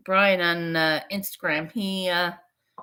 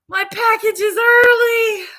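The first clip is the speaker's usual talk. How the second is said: very high, loud, slow squeal of joy; excited shout; 11 dB louder than usual. Retold or imitated. Seeing her package arrived early, a high joyful shout. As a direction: shriek it with delight.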